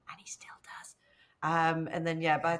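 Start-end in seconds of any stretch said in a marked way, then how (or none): 0.1-1.0 a quick whispered aside. Real, not acted. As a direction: drop to a whisper for a cheeky side remark. Whispered aside about the eldest brother.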